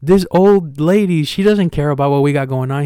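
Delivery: mock-exasperated, pointing out the old lady; excited protest. Urging friend about an old lady.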